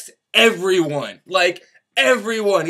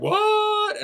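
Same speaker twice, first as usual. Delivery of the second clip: shocked angels. Retold or imitated. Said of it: "all the angels are like".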